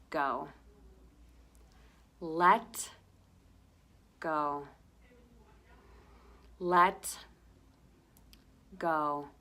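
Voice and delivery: single words spaced out on slow breaths; breathing mantra. Shown as a demonstration. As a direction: softly drop each "go". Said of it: "say to yourself the word".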